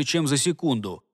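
ordinary delivery, nothing marked

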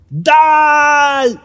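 very high, drawn-out shout; long scream. A narrator's reading. Friend's cry shatters the trance, screamed. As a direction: stretch the name into a desperate scream.

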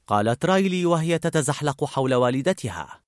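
plain reading voice

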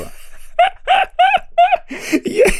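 shrieking falsetto laugh; long high cackle of laughter; hysterical high shriek of laughter. Acted. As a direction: laugh until you almost cry. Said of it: "ледь не плакав від сміху".

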